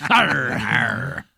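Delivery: slow growly pirate drawl. Acted. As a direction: ham it up like a pirate. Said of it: "in your Steve the Pirate voice".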